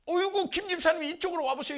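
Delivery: loud high call to a friend; excited calling over. Retold or imitated. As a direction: wave the deacon over, thrilled. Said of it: "막 기뻐한 거예요".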